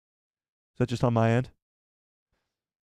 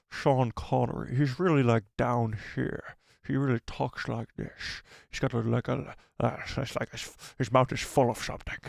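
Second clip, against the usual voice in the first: slurred Scottish film-star impression, dropping softer; Sean Connery; 4 dB quieter than usual. Shown as a demonstration. Streamer showing off how the actor talks, slurred Scottish impression. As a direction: talk like a mouth full of something, slushy s. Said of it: "I could do a pretty good".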